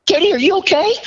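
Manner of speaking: very high, imitated worried squeal; alarmed concern. Doctor checking on crying patient, high-pitched mock alarm. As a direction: play a startled doctor, voice shooting way up.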